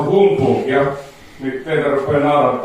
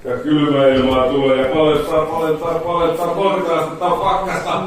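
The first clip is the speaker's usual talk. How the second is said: hypnotic suggestion; 4 dB quieter than usual. Acted. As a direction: lull them into feeling the cold. Hypnotist suggests freezing cold.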